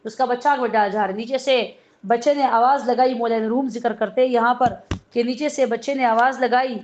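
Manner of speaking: ordinary delivery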